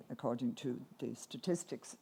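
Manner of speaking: ordinary talk, nothing marked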